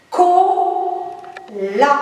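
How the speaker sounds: usual speaking voice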